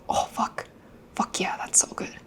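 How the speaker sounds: breathy whisper, savoring food; whispered delight. Retold or imitated. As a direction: whisper it like tasting something amazing. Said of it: "Umai is like".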